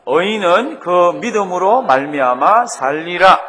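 loud, slow recitation of scripture; reading in unison. Shown as a demonstration. Preacher leads congregation reading the core verse together, loud recitation. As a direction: lead everyone through the verse, loud and measured. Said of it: "다 같이 한번 읽습니다".

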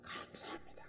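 breathy whispered "감사합니다,"; whispered thanks. Retold or imitated. Preacher acting David's relief, whispering the thanks. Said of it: "막 이렇게 하면서".

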